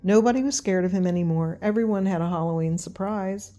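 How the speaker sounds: ordinary delivery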